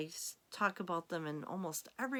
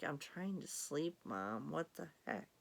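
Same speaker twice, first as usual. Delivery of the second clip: sleepy cat voice; 2 semitones lower, 7 dB quieter than usual. Pretend. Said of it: "Miss Charmy's looking at me like".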